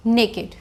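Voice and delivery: put-on "Naked" as two syllables, NAY-kid; correct pronunciation. Shown as a demonstration. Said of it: "How do we pronounce this word?". ("Naked" का सही उच्चारण, ने-किड)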